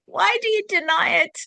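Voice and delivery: high, loud, insistent call; same question cried out to wake them up; loud repeat. Shown as a demonstration. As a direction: fling the question out hard. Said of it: "I'll say it again".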